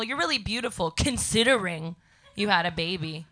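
ordinary delivery

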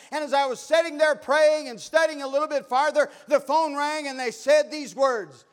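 loud, high preacher's cry; full-force preaching. Acted. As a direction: preach it out to the back pew.

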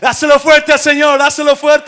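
shouted, high preaching over the crowd; full-force preaching. Acted. Pastor shouting to the church at full force. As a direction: preach it at the top of your lungs.

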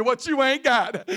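usual speaking voice

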